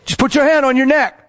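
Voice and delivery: high, projected calling voice; yelling across highway. Retold or imitated. Man instructing driver two lanes over, yelling. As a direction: imitate shouting instructions over traffic. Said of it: "He's yelling across the highway".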